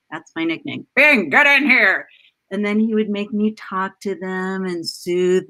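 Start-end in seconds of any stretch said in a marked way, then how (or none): 1.0-2.1 dad calling his daughter into the office, loud; calling a kid in. Retold or imitated. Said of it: "He would say".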